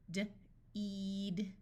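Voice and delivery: D alone, a pause, then a drawn-out "eed"; sounded out. Shown as a demonstration. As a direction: break "D-eed" into its sounds slowly. Phonics teacher sounds out, then blends.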